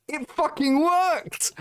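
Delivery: high, slow, disbelieving cry; exasperated outburst. Real, not acted. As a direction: let the outrage burst out high. Furious rant about manipulation.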